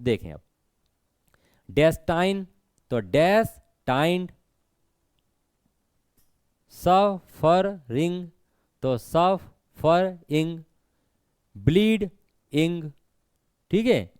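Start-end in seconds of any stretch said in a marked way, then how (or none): 1.7-4.3 destined in two slow, separate beats; broken into syllables. Shown as a demonstration. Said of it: "तोड़कर बोलना पड़े". 6.7-12.9 suffering and bleeding very slowly, syllable by syllable; broken into syllables. Shown as a demonstration. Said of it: "तोड़कर बोलना पड़े".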